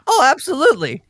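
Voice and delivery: put-on high, emphatic old woman; eager old woman. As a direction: agree readily as a high-voiced grandma.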